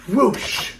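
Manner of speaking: high, loud blown whoosh; whoosh sound. Pretend. Reader leads the children in blowing, high whoosh. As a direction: blow the book open with a big whoosh. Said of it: "when I say whoosh".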